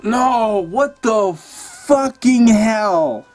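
slow, stretched-out outrage; stunned disbelief. Real, not acted. Gamer watching his party die again, drawn-out disbelief. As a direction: drag every word out in disbelief.

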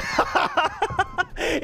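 helpless high cackle; high-pitched laugh. Real, not acted. Laughs at being blocked.

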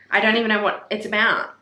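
ordinary talk

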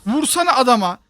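loud, high-pitched order to a teammate; exasperated scolding. Real, not acted. Player telling teammate to hit instead of yelling, loud scolding. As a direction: scold them into fighting back.

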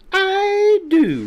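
very high, loud retort; insistent. A narrator's reading. Quinny answering Chick's doubt, high insistent retort.

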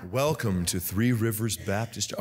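low, smooth welcome, hammed-up; mock suave voice. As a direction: play the smooth-voiced host in jest. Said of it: "smooth voice".

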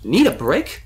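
high, incredulous demand; indignant high-pitched query. A narrator's reading. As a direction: challenge it as a pointed question. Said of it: "the voice demanded".

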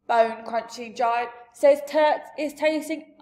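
a big friendly giant holding forth; giant's loud booming talk. Acted.

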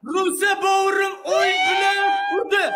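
drawn-out call climbing into a very high cry; ecstatic shout. Real, not acted. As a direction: stretch the name, then shriek with excitement. Celebrating a hit in battle.

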